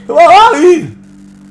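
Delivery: high, drawn-out garbled yell; hammed-up mangled shout; mock slurred singer. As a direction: imitate the singer wailing the hook at full volume. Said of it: "got a quite of a speech impediment".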